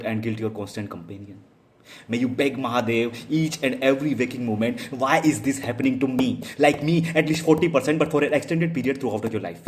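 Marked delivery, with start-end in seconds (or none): 2.1-9.6 loud, rising bitter outburst; impassioned curse. Real, not acted. Wronged lover cursing the one who played them, loud and impassioned. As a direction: let the curse build into a loud plea.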